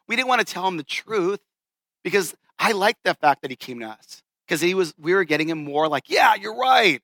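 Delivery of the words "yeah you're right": higher, eager imitation of siding with him; mock agreement. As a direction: egg a buddy on with false support. Friends flattering buddy with agreement.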